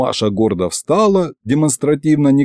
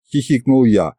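plain reading voice

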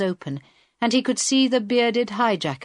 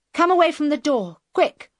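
higher, quick-fire command; urgent order; 8 semitones higher, 4 dB louder than usual. A narrator's reading. Hijacker orders captives away from the plane door, urgent command. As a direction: order them back in a hurry.

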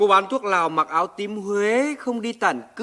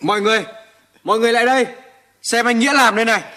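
calling out; 5 semitones higher, 3 dB louder, much faster than usual. Acted. Calling everyone over to look.

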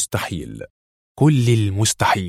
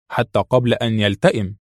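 ordinary delivery, nothing marked

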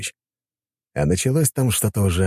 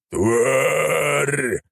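higher, roaring monster cry; dragon roar; 8 semitones higher than usual. Acted. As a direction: roar the insult like a beast. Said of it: "взревел дракон".

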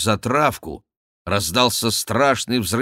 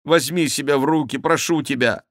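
higher-pitched, imploring appeal; pleading exclamation; 5 semitones higher than usual. A narrator's reading. Henri begs Schomberg to pull himself together, pleading. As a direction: implore him. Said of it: "воскликнул Генрих".

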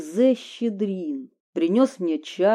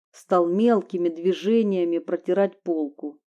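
ordinary delivery, nothing marked

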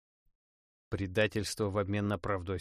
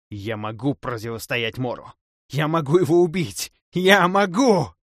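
rising to a loud, higher cry, last words slowed; frenzied shout; 5 semitones higher, 11 dB louder than usual. Acted. Necromancer screams defiance at the god of death, building loud. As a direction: build to a possessed, stretched yell.

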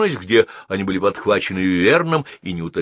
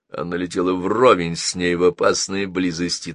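plain reading voice

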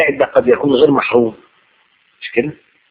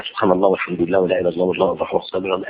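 mock rattled-off dhikr; 7 semitones lower, 6 dB quieter, faster than usual. Mocking a pious judge's muttered dhikr.

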